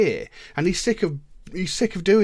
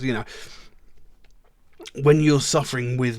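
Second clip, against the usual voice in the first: ordinary talk, nothing marked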